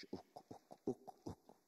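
rhythmic, voiceless repeated whisper; hollow whisper. Acted. Poet echoing the word for hollow, rhythmic whisper. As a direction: whisper it like an empty echo. Hollow echo within a slam poem.

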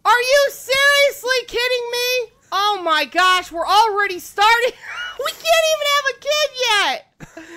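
high, loud panicked yelling at the screen; screaming in real alarm at a burning house; panicked shouting. Real, not acted. Streamer yelling at her sims as the house burns, panicked shout.

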